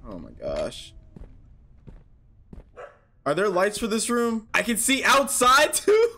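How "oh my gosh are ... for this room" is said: ordinary talk, nothing marked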